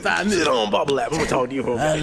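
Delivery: put-on raspy old country dad; smoker's rasp. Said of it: "I got smoker's voice".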